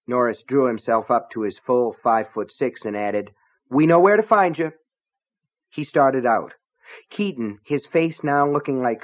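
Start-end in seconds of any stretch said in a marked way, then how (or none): 3.7-4.7 raised, higher warning to a bully; higher, louder threatening deputy; threat. A narrator's reading. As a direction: puff up and threaten him. Deputy drew himself up, threatening.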